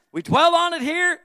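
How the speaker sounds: high, loud cry from the pulpit; preacher's shout at the climax of a point; impassioned preaching shout. Real, not acted. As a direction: belt it out to the congregation.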